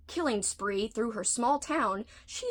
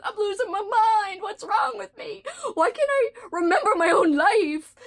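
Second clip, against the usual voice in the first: much higher, louder mock panic; panicked; 10 semitones higher, 6 dB louder than usual. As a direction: freak out dramatically. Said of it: "And I'm like".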